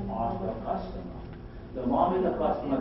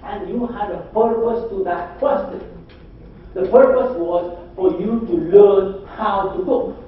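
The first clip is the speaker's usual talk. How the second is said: louder, emphatic mock scolding; upset professor; 4 semitones higher, 8 dB louder than usual. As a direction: act out an annoyed teacher driving the point home. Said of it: "the professor gets really upset".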